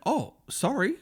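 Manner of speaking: high-pitched sheepish "oh, sorry"; mock apology. Owner apologising to his newly named car, mock apology. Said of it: "I went, I went".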